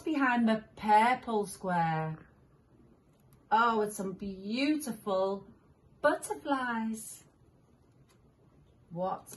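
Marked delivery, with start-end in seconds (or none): none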